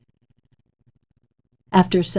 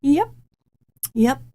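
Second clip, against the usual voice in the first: awkward yep; 8 semitones higher than usual. Retold or imitated. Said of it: "And I'd be like".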